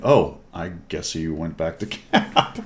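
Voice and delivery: breaking into a laugh at the end; slow, amused thought that dissolves into laughter; laughing realisation. Real, not acted. Player recalling where the enemy went, cracking up laughing.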